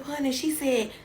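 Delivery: ordinary talk, nothing marked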